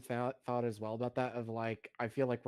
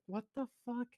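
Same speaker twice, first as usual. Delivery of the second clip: high, softer incredulous question; baffled disbelief; 11 semitones higher, 5 dB quieter than usual. Real, not acted. Viewer reacting to an ungrateful streamer, baffled disbelief.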